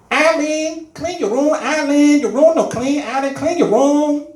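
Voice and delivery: high, loud mock mother; mom scolding. Mom ordering her son to clean his room, mock scolding. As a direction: nag slowly in the mother's accent. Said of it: "she'd tell me".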